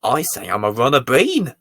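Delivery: ordinary delivery, nothing marked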